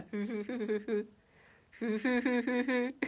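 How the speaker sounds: slow, drawn-out creepy chuckle; a slow, eerie giggle. Real, not acted. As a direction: chuckle slowly like someone scheming. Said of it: "文字に起こすとめっちゃ怖いね".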